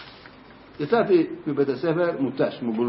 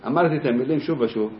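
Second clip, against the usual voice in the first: plain reading voice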